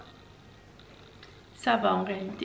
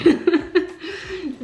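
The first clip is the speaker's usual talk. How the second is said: embarrassed laugh; 6 semitones higher, 7 dB louder than usual. Real, not acted. Laughs at own bad accent.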